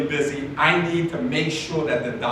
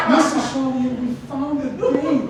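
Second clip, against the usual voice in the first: put-on high, delighted old-lady voice; precious older lady in a high-pitched singsong; sweet grandmotherly receptionist; 8 semitones higher, 4 dB louder than usual. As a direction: announce it like a thrilled nice grandma. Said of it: "kinda like a grandmother, just really nice".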